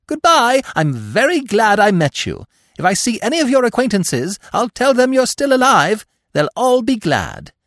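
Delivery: very high, loud calls from the branches; shouting farewell. A narrator's reading. Squirrel calling down farewell to Bambi, loud and high. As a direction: shout your goodbyes from above. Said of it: "he shouted down".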